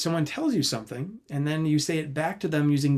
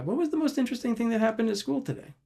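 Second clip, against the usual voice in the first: put-on bright, higher, quick question to a child; open question demo; 9 semitones higher, faster than usual. Shown as a demonstration. Parent invites child with a cheerful, higher question. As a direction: ask it up and lively, inviting an answer. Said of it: "Open would be something like".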